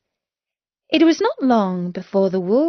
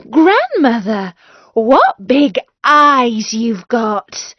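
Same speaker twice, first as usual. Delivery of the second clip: put-on a touch higher, slower wondering girl; a girl marvelling at grandmother, slow; lightly voiced amazed girl; amazed little girl; 3 semitones higher, 7 dB louder, slower than usual.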